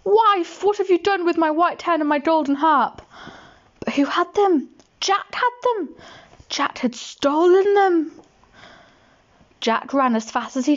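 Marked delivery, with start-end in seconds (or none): 0.0-3.0 high calling giant voice. Acted. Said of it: "he heard him calling". 3.8-8.1 building high excited narration; rising excitement, then a slow punch; dramatic reveal. A narrator's reading.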